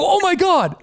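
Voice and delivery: as if the floor just dropped out; high mock shock. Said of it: "And I go".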